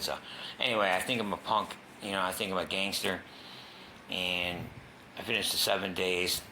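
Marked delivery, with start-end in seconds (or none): none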